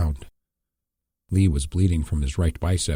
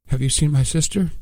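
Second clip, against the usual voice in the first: higher, fearing the answer; fearful; 9 semitones higher, 4 dB louder than usual. A narrator's reading. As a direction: ask as if dreading the reply. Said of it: "fearing the answer".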